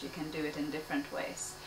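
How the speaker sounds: usual speaking voice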